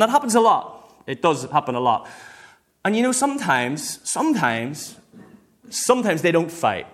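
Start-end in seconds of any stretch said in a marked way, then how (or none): none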